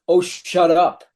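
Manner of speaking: sudden loud outburst, pitch up; shouting. Retold or imitated. Soldier shouts at his wife on the platform. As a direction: snap it out loud at her.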